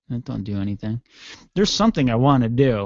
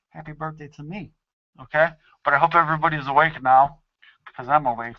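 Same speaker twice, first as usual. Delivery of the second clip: ordinary delivery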